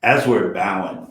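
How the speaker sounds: usual speaking voice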